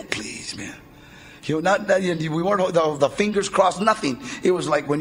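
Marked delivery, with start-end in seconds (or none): 0.0-0.7 near-whispered, much softer begging; imitating a desperate friend, hushed; desperate plea. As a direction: breathe out a hushed, desperate please. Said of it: "with that desperation".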